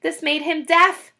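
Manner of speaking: plain reading voice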